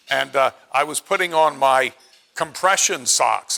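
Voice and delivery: ordinary delivery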